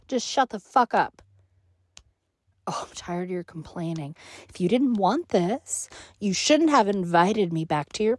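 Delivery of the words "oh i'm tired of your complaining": quiet exasperated. Acted. Contemptuous aside.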